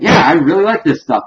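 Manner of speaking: high, loud, eager mock enthusiasm; imitated delight. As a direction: gush like an imitated kid with candy. Voicing Jack delighted by candy.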